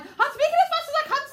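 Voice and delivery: high, loud, flustered gabble; as fast as possible. Shown as a demonstration. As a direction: protest at breakneck speed. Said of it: "Ralph is like, "Get on with it".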